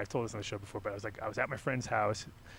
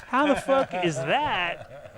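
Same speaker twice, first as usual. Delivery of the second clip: disbelief; 6 semitones higher, 5 dB louder, much slower than usual. Retold or imitated. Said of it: "and you're like".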